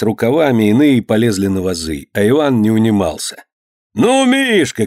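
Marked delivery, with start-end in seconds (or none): 3.9-4.9 high, urging holler; calling out. A narrator's reading. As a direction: call out high to egg him on. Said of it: "кричал он". (высокий задорный окрик)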